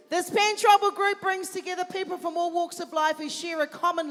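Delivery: loud, proud announcer read; event MC read. Acted. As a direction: present the group with pride. MC resumes the group's introduction.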